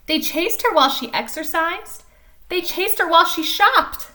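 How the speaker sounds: lively storybook reading voice; animated picture-book narration; reading to children. A narrator's reading. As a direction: read it like story time for little kids. Reads picture book aloud.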